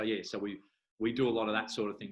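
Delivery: ordinary delivery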